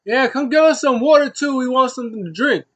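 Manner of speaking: high, loud mock holler; imitated yell. Shelton yelling for water to go with the food, imitated holler. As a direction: call out an order across the room, hammed-up. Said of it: "Shelton just yells".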